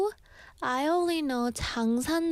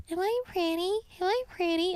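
high, sweet copycat of her own voice; mimicked voice; 9 semitones higher than usual. Mimic creature copying the host's voice to lure hikers, high and sweet. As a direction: echo her own voice back, pitched way up to lure. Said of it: "So it can mimic my voice".

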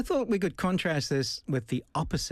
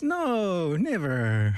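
drawn-out, swooping "no, never"; mock innocent denial; 4 semitones higher, much slower than usual. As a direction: deny it with playful exaggeration. Repeats the playful denial.

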